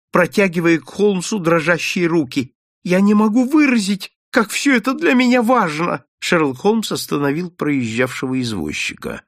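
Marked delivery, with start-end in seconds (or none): 2.8-6.0 pleading excitement. Acted. Overjoyed man begs Holmes.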